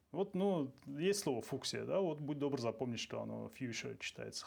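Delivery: usual speaking voice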